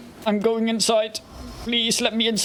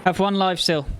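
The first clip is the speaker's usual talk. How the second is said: normal talking voice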